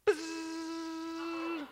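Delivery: high buzzing hum for the window going down. Retold or imitated. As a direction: mimic the power window with a buzz. Imitating the window motor.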